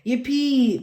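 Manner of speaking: excited high yippee; shout. Acted. Little pig shouting for joy at puddles, excited shout. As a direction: cheer it out loud. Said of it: "Pepper shouts".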